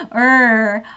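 loud curled 얼; R sound demo. Shown as a demonstration. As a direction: curl the tongue back on 얼. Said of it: "이런 식으로 연습".

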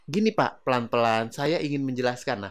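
relaxed address to a client. Shown as a demonstration. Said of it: "dalam kondisi yang rileks dan lebih santai".

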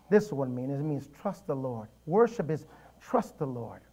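ordinary talk

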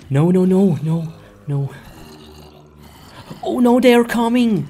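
fast, high, breathless panic; panicked rush. Real, not acted. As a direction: gabble it out in a hurry. Zombies coming, running away.

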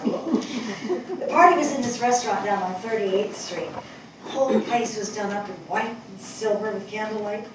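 normal talking voice

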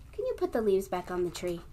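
ordinary delivery, nothing marked

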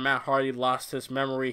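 normal talking voice

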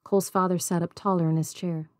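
usual speaking voice

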